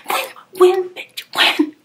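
high, loud retold outburst; yelling at him in the car; mock angry yell. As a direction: snap back loud and high in disbelief. Heated retold argument.

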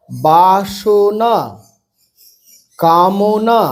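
very slow, stretched syllables; broken into syllables. Shown as a demonstration. Teacher reading practice words for beginners, syllable by syllable. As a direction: draw out each syllable of বাসনা, কামনা. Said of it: "ভেঙে ভেঙে পড়বে".

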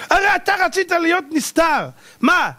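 high, wondering challenge; acted astonishment. Wife asking why he gave up secrecy, high astonishment.

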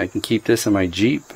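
normal talking voice

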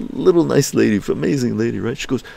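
ordinary delivery

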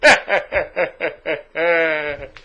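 loud gloating laugh; real laugh at his own joke; loud pleased laughter; hearty laugh. Real, not acted.

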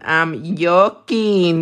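slow, playful drawl on "joking"; drawn-out joking. Real, not acted. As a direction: stretch out JOKING slowly. Repeats it drawn out for fun.

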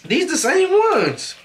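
high, slow and stretched out; high drawn-out voice. Real, not acted.

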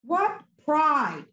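ordinary talk, nothing marked

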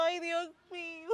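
sobbing lament, soft and broken; crying. Acted. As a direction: weep it out to yourself. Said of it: "Que te veo llorando".

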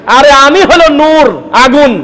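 voicing Iblis boasting he is made of fire; arrogant Iblis. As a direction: puff up like the proud devil claiming to be fire. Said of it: "মানে আল্লাহকে বলতেছে".

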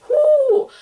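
high, loud cheer; real excited whoop; excited whoo. Real, not acted. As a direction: whoop with delight. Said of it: "fangirl cheer".

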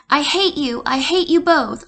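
loud, high angry yell at both parents; hollering in anger. Acted. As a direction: hurl it at them, building as it goes. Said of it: "I holler at them".

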